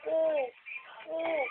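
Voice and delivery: ordinary delivery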